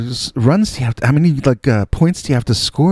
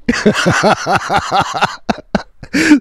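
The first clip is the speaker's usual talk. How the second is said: long high cackling laugh; hearty laugh; 10 semitones higher than usual. Real, not acted. Storyteller amused by his younger self, laughing hard. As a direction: burst out laughing at your own story.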